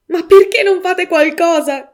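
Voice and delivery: high, loud, slow plea; grieving mother demanding action, shouting high and loud; desperate shout. A narrator's reading. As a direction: shout at the police in despair. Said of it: "gridò la signora Reeves".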